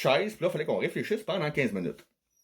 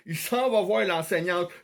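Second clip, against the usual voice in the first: ordinary talk, nothing marked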